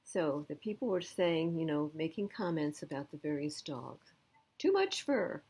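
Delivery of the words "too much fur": much higher, picky shelter visitor; high mock shopper voice. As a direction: sniff at the dog like a fussy customer. People judging dogs at shelter.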